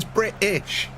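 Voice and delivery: "British" said slowly, T left out; dropped T. Shown as a demonstration. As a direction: glottal stop in place of the T in "British". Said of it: "we don't say a T".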